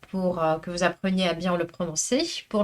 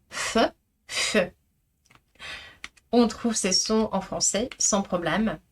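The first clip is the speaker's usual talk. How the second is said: ordinary talk, nothing marked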